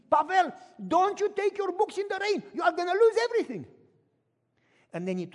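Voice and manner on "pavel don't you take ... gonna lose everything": high, loud warning yell; old neighbor yelling a warning; neighbor's yell. Retold or imitated. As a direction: shout across the street. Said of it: "And he screamed".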